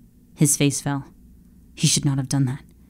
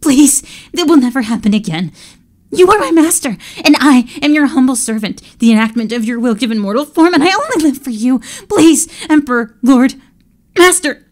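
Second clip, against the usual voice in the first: high, loud, frantic pleading; terrified servant begging master; desperate begging; 7 semitones higher, 5 dB louder than usual. Acted. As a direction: grovel for your life. Servant begging for his life.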